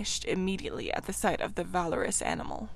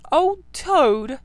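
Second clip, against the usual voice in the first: laughing heartily; 7 semitones higher, 9 dB louder than usual. A narrator's reading. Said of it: "laughing heartily".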